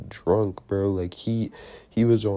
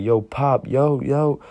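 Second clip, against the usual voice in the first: louder, rising urgent call to dad; acted holler into the house; 5 semitones higher, 4 dB louder, slower than usual. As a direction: call out to get his attention. Calling his dad inside the house.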